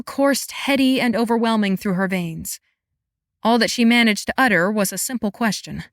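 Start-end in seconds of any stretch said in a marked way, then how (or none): none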